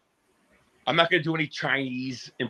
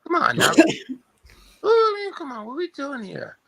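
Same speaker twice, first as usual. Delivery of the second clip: put-on high, halting old man rambling; Joe Biden impression; 6 semitones higher than usual. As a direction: stumble through it like sleepless Biden. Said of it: "working on my Joe Biden".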